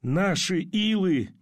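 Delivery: high, drawn-out exclamation; urgent inner exclamation. A narrator's reading. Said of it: "он подумал одно".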